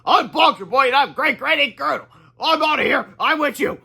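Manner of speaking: high, loud proud hero voices; superhero intro. Acted. New heroes proclaiming their names, loud proud intro. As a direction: announce yourselves boldly.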